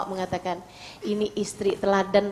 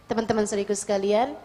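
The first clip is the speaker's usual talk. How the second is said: ordinary delivery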